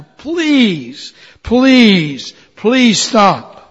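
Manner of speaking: high, loud begging; crying out. Acted. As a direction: plead with the master to stop. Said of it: "I cried out to the master".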